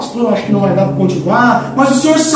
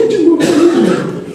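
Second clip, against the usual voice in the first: mock dismay; 9 semitones higher than usual. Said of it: "vai sair do quarto e diz".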